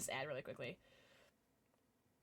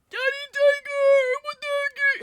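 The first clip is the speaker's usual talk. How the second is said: very high, loud cartoon squeak; squeaky high voice; 15 dB louder, slower than usual. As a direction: squeal it like a tiny cartoon critter. Thanking sub in silly voice.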